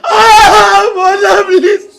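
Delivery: loud, tearful begging that surges into cries; sobbing wail. Acted. Child begging dead mother to stand and drink, wailing. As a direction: beg and wail through heavy sobs.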